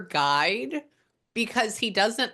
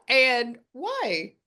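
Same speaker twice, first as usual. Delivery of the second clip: ordinary talk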